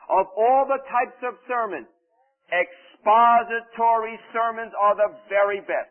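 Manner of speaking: loud, slow, high proclamation; weighty, drawn-out pulpit delivery; emphatic quote reading. Acted. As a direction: read it out like a preacher's proclamation. Reads a book quote emphatically.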